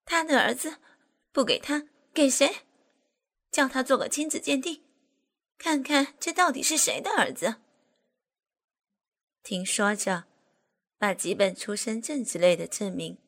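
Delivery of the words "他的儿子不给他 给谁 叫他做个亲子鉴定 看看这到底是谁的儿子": high, loud and quick; indignant. Acted. Woman demanding a paternity test from the aide, indignant. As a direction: demand it angrily.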